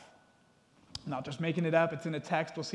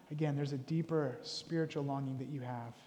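normal talking voice

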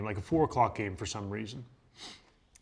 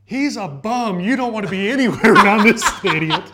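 mocking girlfriend; 9 semitones higher, 12 dB louder than usual. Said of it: "His girlfriend just starts busting on him".

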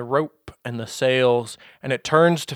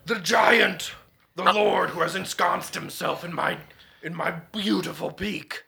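dragon voice; 4 semitones higher, 5 dB quieter than usual. Acted. Dragon speaks of the giant.

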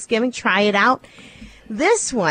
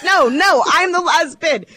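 higher, louder insistent bid; insistent bidder; 7 semitones higher, 3 dB louder than usual. Retold or imitated. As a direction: insist it's your bid. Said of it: "And then they're like".

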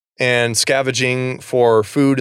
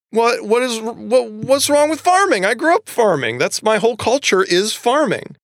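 much higher, stammering indignation; offended Susan; 11 semitones higher than usual. Retold or imitated. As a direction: squeak out the hurt pride of a farmer. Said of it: "Susan takes offense to this".

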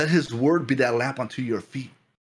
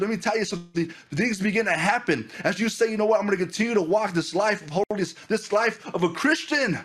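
high, driving preacher's cry to the congregation; fervent preaching; 9 semitones higher than usual. Acted. Pastor urging the young church to pursue God, fervent preaching. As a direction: preach it out with full fire, voice lifted high.

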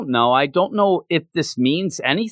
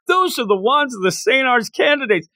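retold yell; 8 semitones higher than usual. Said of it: "He's yelling".

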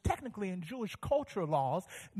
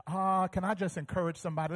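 ordinary talk, nothing marked

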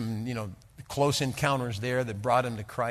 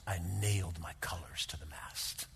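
whispered, reverent and intense; hushed whisper; 7 semitones lower, 9 dB quieter than usual. Retold or imitated. Old veteran confiding his lifelong vow, whispered.

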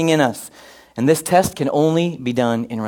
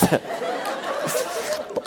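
breathless high unvoiced laugh; real laugh; 11 dB quieter than usual. Real, not acted. Pastor laughing at his joke about his mother, real laugh. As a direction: laugh at your own inappropriate joke.

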